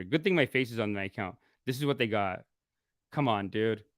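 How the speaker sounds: ordinary talk, nothing marked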